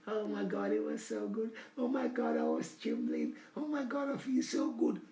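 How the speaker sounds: high, soft, ecstatic mock woman's voice. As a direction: play her swooning over the memory, softly and high. Acted woman's ecstatic memory.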